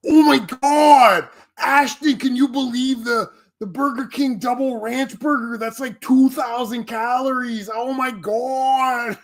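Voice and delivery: high, drawn-out disbelieving voice; impression of Andy. Imitating Andy from Kino Casino marveling at a burger, high and stretched. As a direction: gasp it out like a stunned bro. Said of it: "Here's Andy".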